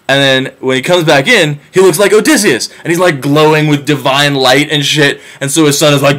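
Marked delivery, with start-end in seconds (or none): none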